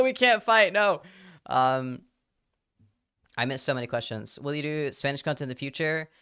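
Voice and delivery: usual speaking voice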